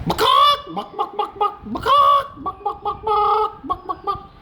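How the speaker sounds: high clucking hen; clucking chicken. Acted. Chicken sound in story.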